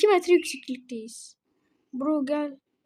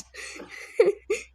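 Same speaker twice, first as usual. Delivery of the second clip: high, breathy burst of laughter; short wheezy laugh; real laugh; 5 semitones higher, 4 dB quieter than usual. Real, not acted. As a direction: giggle helplessly at a friend. Laughs at bumping friend.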